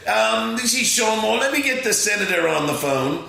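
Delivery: mimicking his own fake aide answering the call; put-on assistant voice. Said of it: "I go".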